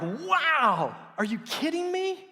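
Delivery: loud, high delighted shout; thrilled. Retold or imitated. First talking with his wife, a loud thrilled shout. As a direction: shout it in happy disbelief. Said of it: "I'm like".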